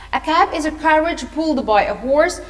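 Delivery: ordinary delivery, nothing marked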